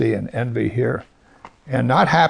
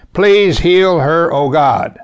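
loud, slow, higher cry of Please heal her; fervent prayer; 4 semitones higher, 6 dB louder, slower than usual. Shown as a demonstration. Preacher shows the congregation a simple prayer, loud fervent plea. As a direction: call out the short prayer with full force. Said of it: "Can you say five words".

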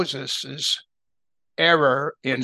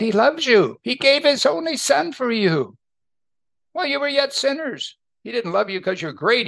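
impassioned preaching; 8 semitones higher than usual. Real, not acted. Preacher stresses God's love.

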